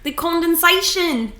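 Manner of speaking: put-on light New Zealand accent; a hint of a Kiwi accent. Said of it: "Rate my New Zealand accent".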